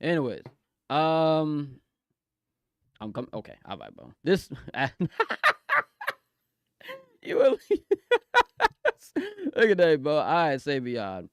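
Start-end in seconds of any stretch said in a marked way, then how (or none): 4.7-7.8 high, loud squealing laughter; real burst of laughter; shrieking laugh. Real, not acted. Laughing hard.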